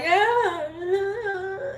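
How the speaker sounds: high, whimpering fake sob on each yeah; hammed-up weepy yeah; mock crying. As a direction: mimic her teary whine, way up high. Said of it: "she just came off like".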